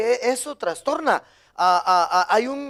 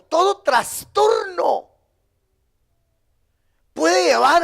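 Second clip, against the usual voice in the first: preaching shout; 8 semitones higher, slower than usual. Real, not acted. Preacher warning the congregation, slow shout. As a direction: cry out "todo trastorno" to the church.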